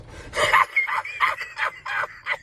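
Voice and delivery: a very high, airy shriek of laughter. Real, not acted. Vlogger laughing about God talking to Robin, shrieking laugh. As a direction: burst out in a howling cackle. Cackling at own joke.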